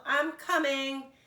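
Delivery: high, slow calling out. A narrator's reading. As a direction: call to a friend in trouble. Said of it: "Big Bird called".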